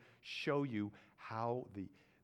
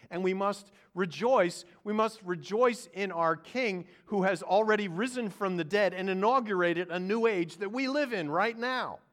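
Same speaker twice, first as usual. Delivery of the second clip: high, loud preaching climax; full-force preaching; 10 semitones higher, 14 dB louder than usual. Acted. Preacher proclaiming the risen King loudly. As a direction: lift the church with it.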